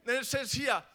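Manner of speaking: ordinary talk, nothing marked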